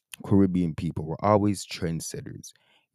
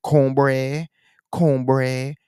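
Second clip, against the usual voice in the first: loud exaggerated slang imitation; high, loud mocking slang; mock Toronto slang; 7 semitones higher, 7 dB louder than usual. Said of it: "people don't talk like".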